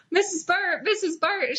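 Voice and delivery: calling out. A narrator's reading. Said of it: "she yelled".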